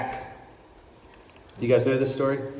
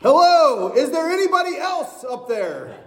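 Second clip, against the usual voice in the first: man on the branch calling out for anyone else; desperate Jack; 10 semitones higher than usual. Acted. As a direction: shout past God looking for another option. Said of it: "And then Jack goes".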